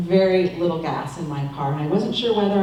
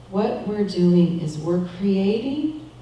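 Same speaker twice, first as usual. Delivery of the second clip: usual speaking voice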